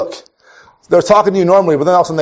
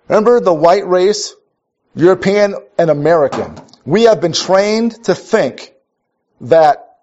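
ordinary delivery

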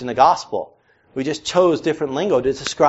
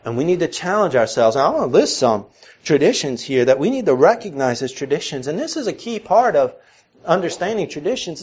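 ordinary delivery

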